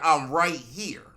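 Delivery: loud, slow mock wife; drawn-out claim from a jealous wife; wife jumping in. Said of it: "my wife had jumped out the cabin and was like".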